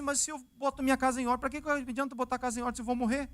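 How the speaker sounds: quick mock complaint of a doomed king; rushed protest. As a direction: blurt it out quickly in disbelief. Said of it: "Fala assim".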